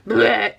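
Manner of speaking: mock gag noise through laughter; laughing retch. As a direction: make a playful vomiting sound while laughing. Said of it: "So I am laughing".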